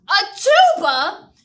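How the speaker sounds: high, loud, slow disbelieving question; shocked echo. Retold or imitated. Miss Irby echoing Herman in shock.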